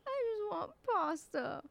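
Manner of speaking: high, slow teary imitated whine; tearful whine. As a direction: mimic a teary friend begging. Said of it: "She's like, her eyes were watering".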